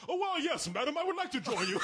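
overly proper, theatrical acceptance; formal gentleman. Acted. Guy accepting a girl's invite, pompous gentleman voice. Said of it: "It's James Earl Jones".

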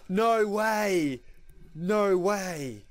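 high, loud, thrilled shout of disbelief; can't believe the pull, half-yelling; excited disbelief. Real, not acted. Rare card pulled in opening.

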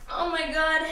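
ordinary delivery

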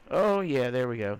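loud high oh yeah; real excited whoop; triumphant. Real, not acted. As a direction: cheer the win. Trick worked, triumphant.

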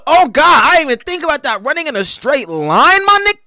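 high-pitched, loud disbelief; worked up, voice way up high; incredulous outburst. Real, not acted. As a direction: react like you can't believe the blunder. Stunned by the straight-line run.

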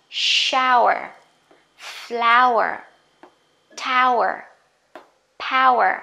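"Shower, flower, tower, power" each punched loud on the first vowel, falling away; stressed first syllable. Shown as a demonstration. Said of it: "dấu nhấn cho nó". (nhấn mạnh âm "ao" trong "Shower, flower, tower, power")

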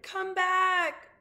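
high, loud, stretched-out yell; shouted call. A narrator's reading. Abilene calls her rabbit back from the sea, shouting. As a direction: shout after someone far away. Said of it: "she shouted".

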